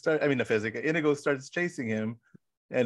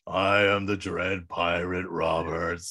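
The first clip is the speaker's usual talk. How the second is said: low, slow booming proclamation; Dread Pirate Roberts; 4 semitones lower, slower than usual. Retold or imitated. Retelling Andre's speech to scare the guards, ominous pirate voice. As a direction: intone it slowly in a deep, ominous pirate voice. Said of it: "going into this, like, uh, dialogue where he's like".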